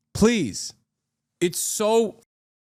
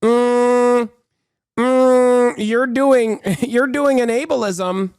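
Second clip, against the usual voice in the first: mocking condescension; 5 semitones higher, 4 dB louder, much slower than usual. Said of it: "trying to be like".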